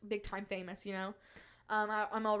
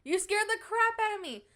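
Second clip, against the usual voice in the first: startled; 11 semitones higher, 6 dB louder than usual. Real, not acted. Startled by dad.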